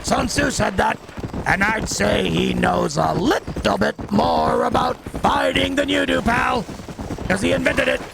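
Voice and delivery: gruff cowboy drawl; lightly voiced old cowboy; Cowboy Pete voice. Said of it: "Cowboy Pete voice".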